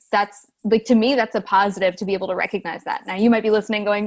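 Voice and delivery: ordinary delivery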